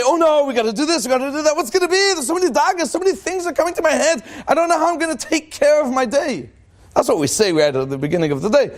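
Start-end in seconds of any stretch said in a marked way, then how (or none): 0.0-6.5 higher, fretful, hammed-up worry; mock morning panic. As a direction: rattle off the worries like a frazzled person. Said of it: "we wake up in the morning and we say".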